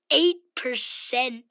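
loud, stunned outburst; shocked exclamation. Real, not acted. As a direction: blurt it out in disbelief. Shocked at low iPod battery.

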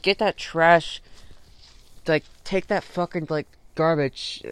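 fed-up, emphatic purge; forceful disgust. Acted. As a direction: order it out with slow, heavy emphasis. Acts out ordering bad thoughts out.

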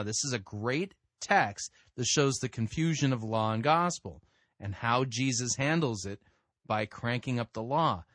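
normal talking voice

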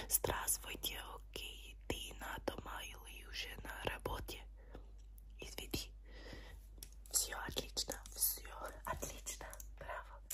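very soft breath-only Russian; whispered Russian. Shown as a demonstration. Doctor testing patient's hearing, whispered Russian. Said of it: "whisper some languages in your ear".

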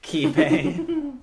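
high, loud hyped call; excited shout. Acted. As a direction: belt it out like a jingle. Echoing the show name, hyped.

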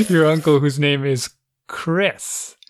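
slow, over-patient explaining; mock-patient parent, slowed right down; slow spelled-out patience. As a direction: lay it out slowly like it's obvious. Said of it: "I'm like".

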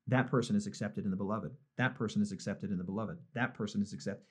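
softly repeating a private blessing to himself; quiet inner thought. Retold or imitated. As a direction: bless each passer-by under your breath. Silent thought while watching airport crowds.

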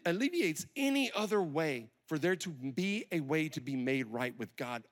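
ordinary delivery

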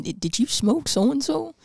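slow, high commercial voice; mock TV ad announcer; injury lawyer ad. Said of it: "there's gonna be a commercial saying".

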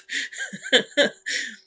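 high burst of laughter; big laugh. Real, not acted. Laughing at his own ad pitch to Monster, high laughter.

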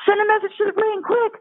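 tiny creatures urgently calling for Oreos; excited critters. Retold or imitated. Revived critters begging the brain for Oreos, high and squeaky.

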